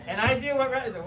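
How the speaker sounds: ordinary delivery, nothing marked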